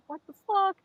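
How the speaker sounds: high-pitched bewildered dog voice; a startled pet, squeaky and loud; voicing the dog. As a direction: owner voicing her scared dog. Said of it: "and Coconut's like".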